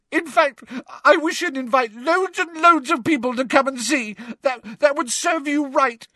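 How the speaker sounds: high, loud wailing rant; sobbing tirade. A narrator's reading. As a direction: build from tearful giving-in to a shrill outburst. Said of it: "now sobbing into her hands".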